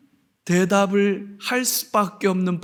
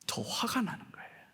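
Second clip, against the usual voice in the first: hushed whisper; 18 dB quieter than usual. Real, not acted. Preacher confiding the Pharisees' anger, whispered. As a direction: breathe it out secretly.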